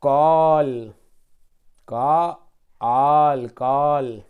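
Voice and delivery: कॉल loud, then का and आल drawn out slowly before कॉल; sounding out call. Shown as a demonstration. Teacher to children, slow loud blending of कॉल. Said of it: "इसी तरह".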